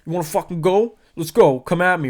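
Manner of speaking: hammed-up come-at-me taunt; mock tough-guy challenge. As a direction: imitate a hothead daring someone to swing. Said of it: "Chris is like".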